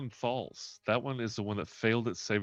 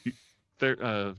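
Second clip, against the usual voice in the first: normal talking voice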